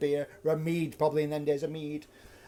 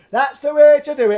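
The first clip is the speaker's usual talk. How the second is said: mocking drunk; 8 semitones higher, 11 dB louder than usual. Drunk ruffian taunting the puppeteer with a loud mock Punch voice. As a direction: taunt him in a squawky Punch voice. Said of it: "Every time he picks his glass up".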